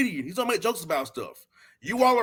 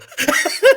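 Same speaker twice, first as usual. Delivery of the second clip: high, loud burst of laughter; real loud laugh; 10 semitones higher, 8 dB louder than usual. Real, not acted. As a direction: crack up at your own boast. Laughs after his own boast.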